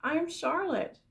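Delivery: light, welcoming hello; friendly spider. Acted. Spider introduces herself to pig, lightly voiced.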